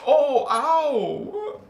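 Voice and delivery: sudden high sharp "ow"; mock yelp of pain. Speaker jokingly breaks off, mock yelp of pain. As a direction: yelp as if something just stung.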